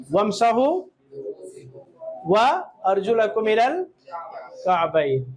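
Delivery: slow, drawn-out Arabic recitation; Quran recitation. Acted. Teacher recites the wudu verse to pupils, slow and chanted. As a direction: chant the verse slowly and carefully.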